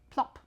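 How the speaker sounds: a big popping plop; loud plop. Pretend. Child asked to make a loud pop. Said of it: "give us a nice big loud pop".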